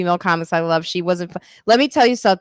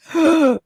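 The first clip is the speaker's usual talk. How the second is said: mock gasp; 8 semitones higher than usual. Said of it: "and I was like".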